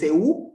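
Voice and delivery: E U in two syllables, U punched up; vowels split apart. Shown as a demonstration. Teacher warns this is no diphthong, E U split in two. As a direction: break E U into two separate vowels. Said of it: "Estamos pronunciando dos sílabas, dos vocales separadas".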